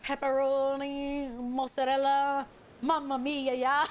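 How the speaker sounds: slow, stretched mock Italian accent; hammed-up Italian plumber accent; Mario accent. Shown as a demonstration. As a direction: draw out a cartoon Italian accent. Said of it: "Mario accent".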